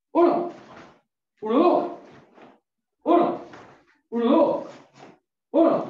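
high shouted count; barking gym coach. Real, not acted. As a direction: bark out the count loud and high. Coach counting drill reps aloud.

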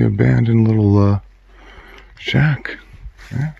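normal talking voice

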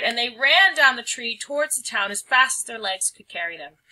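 plain reading voice

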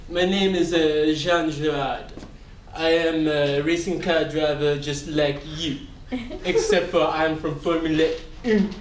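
a smug French racing driver with a hint of French accent; Jean Girard.

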